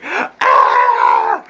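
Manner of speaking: high breathless shriek of disgust; loud shrieking ah of horror; disgusted scream. Retold or imitated. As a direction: recoil screaming. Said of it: "I'd be like".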